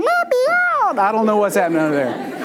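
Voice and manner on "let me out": tiny, very high squeaky plea; cartoonish high-pitched food voice; trapped chicken nugget. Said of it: "chicken nugget under there going".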